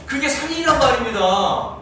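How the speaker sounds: ordinary talk, nothing marked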